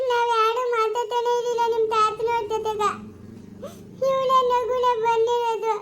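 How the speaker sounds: high, slow keening complaint; crying lament. Acted. Woman telling Tarunya of betrayal, wailing tearfully. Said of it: "ಅಳ್ಬೇಡ ನೀನು".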